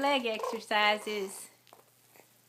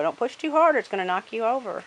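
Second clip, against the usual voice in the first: quick, loud warning; urgent warning; 8 dB louder, much faster than usual. Real, not acted. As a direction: hurry the warning out. Warning a child at swing.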